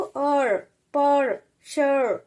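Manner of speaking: अर पर शर drawn out very slowly; अर पर शर spoken syllable by syllable; slow demo sounds. Shown as a demonstration. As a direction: stretch out अर, पर, शर. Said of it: "उसको इस तरीके से बोलेंगे हम".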